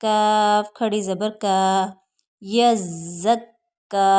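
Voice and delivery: slow, drawn-out letter-by-letter recitation; slow spelling drill. Shown as a demonstration. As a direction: spell each letter out slowly for the class. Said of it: "यहाँ दोनों हरूफ को सख्ती के साथ पढ़ना है".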